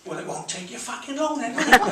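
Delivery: lightly voiced Cockney bouncer; mild Cockney retort to Lord Coe; Cockney geezer. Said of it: "this big Cockney geezer said".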